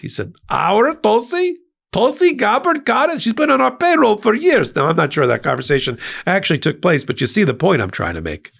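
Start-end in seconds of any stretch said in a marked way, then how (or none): 0.5-4.8 cartoon Russian accent jumping much higher in delighted surprise; hammed-up Russian spy boss, gleeful and high; bad Russian accent, surprised. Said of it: "really bad stereotypical Russian accent".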